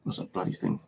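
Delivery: a quick unvoiced aside while groping for a word; whispered aside. Real, not acted. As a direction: whisper it under your breath. Muttered aside while searching memory.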